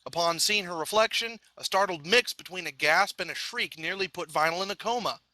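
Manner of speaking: plain reading voice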